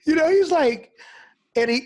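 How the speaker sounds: laughing high, words breaking up; can't get the words out for laughing. Real, not acted. Retelling the pastor friend's joke, laughing hard. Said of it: "I start laughing".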